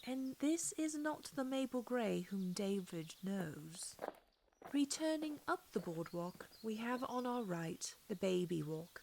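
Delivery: usual speaking voice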